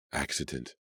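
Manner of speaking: soft, stunned single word; stunned whisper. Acted. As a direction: let it fall out in shock. Martin stunned.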